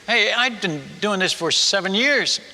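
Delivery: usual speaking voice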